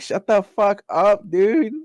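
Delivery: hammed-up annoyed scold at the cat; mock annoyed. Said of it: "I'm like".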